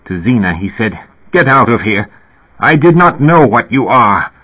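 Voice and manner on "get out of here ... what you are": raised, louder, angry order to leave; narrated outburst of anger and dismay; violent dismay. A narrator's reading. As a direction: drive her off with alarmed indignation. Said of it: "With violence and dismay he regarded her".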